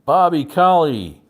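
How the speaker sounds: auctioneer call. Acted. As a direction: call the bid to the room. Auctioneer closing the sale.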